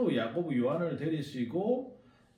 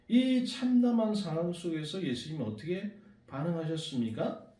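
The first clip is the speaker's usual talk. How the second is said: usual speaking voice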